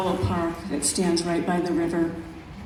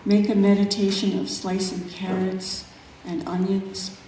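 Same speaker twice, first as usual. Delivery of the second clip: measured, unhurried poetry reading; calm, reflective recital of a nature poem. Acted. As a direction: let each image breathe in its pauses. Reads her second poem.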